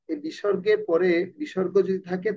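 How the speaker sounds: ordinary talk, nothing marked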